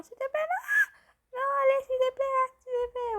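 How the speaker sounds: very high, loud, laughing-crying plea; half-laughing high whine of please, please; desperate begging. Real, not acted. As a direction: wail at it to please stay. Begging the pet not to leave.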